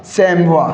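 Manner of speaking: सैमवा ending on an open "wa"; singular pronunciation. Shown as a demonstration. Teacher repeating the singular for students, सैमवा as "saim-wa". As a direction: say सैमवा with no final consonant. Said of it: "correct जो pronunciation है".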